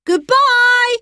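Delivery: high, loud farewell call; children calling to a departing train; children waving off the old gentleman; calling goodbye. A narrator's reading. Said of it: "they all called".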